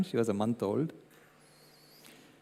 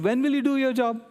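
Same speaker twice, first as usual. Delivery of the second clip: put-on much higher, louder, insistent little girl; demanding child; 10 semitones higher, 3 dB louder than usual. Granddaughter demanding action from grandpa, in a bossy child voice. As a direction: press him like a bossy kid. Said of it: "And she said".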